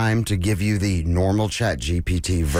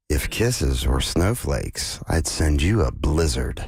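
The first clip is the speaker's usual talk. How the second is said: slow seductive; 6 semitones lower than usual. Acted. Dan's sexy line, slowed.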